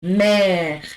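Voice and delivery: mère with open è; French mère. Shown as a demonstration. Teacher modelling mère for students. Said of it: "We have".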